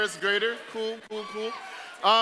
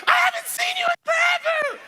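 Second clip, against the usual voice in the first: very high, loud squealed greeting; mock squeal; 5 dB louder than usual. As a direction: gush over a friend seen yesterday. Imitating excited ladies.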